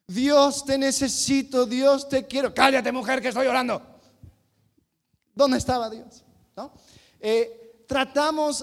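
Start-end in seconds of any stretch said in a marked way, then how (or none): 0.1-2.5 loud, higher hammed-up praying; pious husband praying loudly to God; mock fervent prayer. Showy prayer mocked. 2.5-3.8 loud dismissive snap, a touch mocking; husband silencing his wife while he prays; mock irritated husband.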